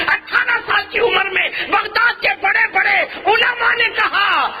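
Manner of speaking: high, ringing sermon climax; preaching at full force. Acted. Preacher telling the congregation of the saint's rise, high and shouting. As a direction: lift the crowd with a shouted climax.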